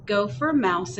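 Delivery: ordinary delivery, nothing marked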